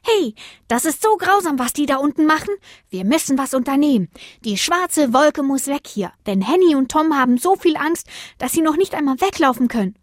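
hohe, laute Sternchenstimme voller Aufregung; aufgeregt rufend. A narrator's reading. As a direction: ruf empört den anderen Sternen zu. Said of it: "rief ganz aufgeregt". (aufgeregtes hohes Rufen)

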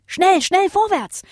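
high, urgent push forward; urging on. Acted. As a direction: spur the boy on fast. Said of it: "feuerte Bodo den Jungen an".

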